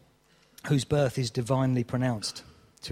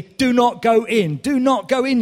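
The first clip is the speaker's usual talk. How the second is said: loud, high urgent warning at the screen; shouting at a horror film on TV; pleading at the TV; 9 semitones higher, 8 dB louder, slower than usual. Pretend. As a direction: beg the character not to go in. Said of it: "you find yourself saying to the T V screen".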